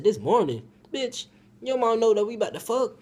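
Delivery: loud, high-pitched disbelief; outraged retort. Retold or imitated. Teller replays his reply to the girl, loud and outraged. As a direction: fire it back at her in incredulous protest.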